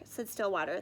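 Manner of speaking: ordinary delivery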